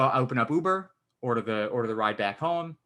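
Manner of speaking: ordinary delivery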